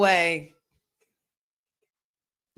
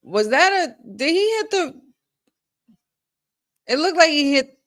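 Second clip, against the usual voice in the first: high, loud, rushed questions; can't believe what she just saw; excited disbelief; 8 semitones higher, 5 dB louder than usual. Real, not acted. Fan watching a near pinfall, high excited disbelief.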